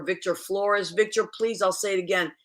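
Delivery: usual speaking voice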